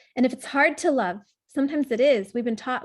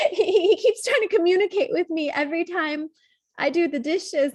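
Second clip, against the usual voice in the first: holding back tears; 5 semitones higher, 3 dB louder than usual. Real, not acted. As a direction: voice breaking, fighting tears. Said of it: "I'm gonna cry".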